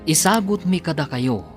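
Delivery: ordinary delivery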